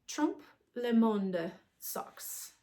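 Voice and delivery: slow, careful French accent on the pattern name. Shown as a demonstration. Naming the sock pattern for listeners, in a slow French accent. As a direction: say it like a proud French speaker, taking your time. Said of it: "said in my best French accent".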